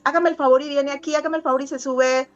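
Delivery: put-on high, fast, overloading a child with instructions; rapid string of orders to a child. Mother rattling off orders to a small child, fast and high. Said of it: "y si tú llegas".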